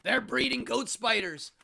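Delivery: hammed-up paranoid warning; Alex Jones. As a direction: rant like a conspiracy radio host. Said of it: "like an Alex Jones thing".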